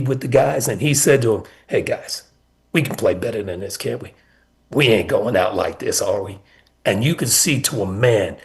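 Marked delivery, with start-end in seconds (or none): 1.7-6.4 low, soft and calm, reassuring the team; quietly steady the players at halftime; calm coach. Retold or imitated. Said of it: "He came in the locker room as calm as can be".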